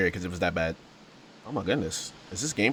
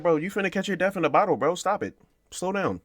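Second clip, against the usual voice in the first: high mock paraphrase voice, much higher; paraphrasing the game quote; 10 semitones higher than usual. Retelling a wine warning to viewers, in a high mock voice. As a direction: squeak out a jokey street version of the old writer's warning. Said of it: "He basically said".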